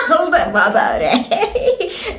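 laughing through the words; slow, amused laugh. Real, not acted. Laughing while repeating remark.

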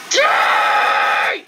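high, rough, drawn-out battle yell; furious gamer shout; raging shout. Real, not acted. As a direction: scream it at the enemy. Yelling at enemy in game.

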